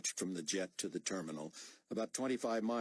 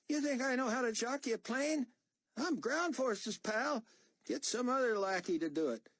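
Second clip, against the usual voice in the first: higher, louder, indignant soldier voice; defiant young corporal; 4 dB louder than usual. Acted. Corporal refusing to refuel the plane, higher and defiant. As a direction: push back hard, you're not his lackey.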